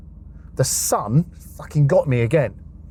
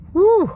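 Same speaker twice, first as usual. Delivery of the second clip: high whistle of shock; 6 dB louder than usual. Retold or imitated. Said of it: "and I was like".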